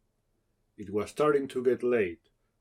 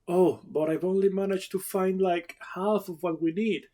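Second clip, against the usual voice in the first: high, whiny complaint from the bushes; Jerry complaining to Tachi from the bushes, high and whiny; complaining young woman; 10 semitones higher than usual. Acted. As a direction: plead to stay a little longer. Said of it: "came a complaint".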